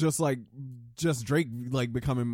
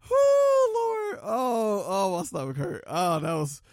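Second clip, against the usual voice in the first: catching breath after laughing; winded from laughing; after laughing hard; 5 semitones higher, 5 dB louder, slower than usual. Real, not acted. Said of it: "I needed that laugh".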